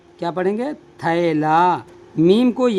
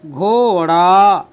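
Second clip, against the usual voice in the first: slow, drawn out; 4 dB louder, much slower than usual. Shown as a demonstration. Teacher answering his own question, slowly drawn out. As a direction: say घोड़ा slowly, each sound held. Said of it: "तो क्या पढ़ेंगे इसे?".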